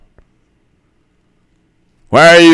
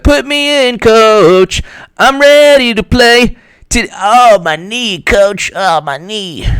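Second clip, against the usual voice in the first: whiny injured player; 8 semitones higher, slower than usual. Retold or imitated. Mock athlete begging coach.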